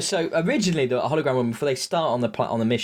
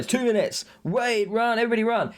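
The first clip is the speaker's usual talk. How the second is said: high, urgent call to run; urgent hologram warning; 9 semitones higher than usual. Retold or imitated. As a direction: shout the warning to the team. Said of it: "and he's going".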